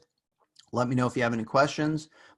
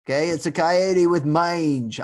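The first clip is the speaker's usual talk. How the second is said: higher, louder hammed-up Australian accent; mock Australian accent; 6 semitones higher, 7 dB louder than usual. Lecturer jokingly debunking a chupacabra sighting to class, mock Australian accent. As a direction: put on a dubious, big Australian accent. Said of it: "all of a sudden I'm an Australian".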